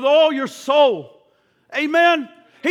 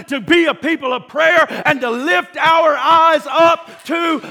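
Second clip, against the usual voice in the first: full-force preaching; 2 semitones higher, 4 dB louder than usual. Acted. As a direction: lift the congregation with your voice. Preacher urging the church upward.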